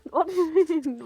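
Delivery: normal talking voice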